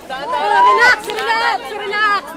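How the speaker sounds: calling out. Real, not acted. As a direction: call out to calm the crowd. Reporter calming jostling crowd.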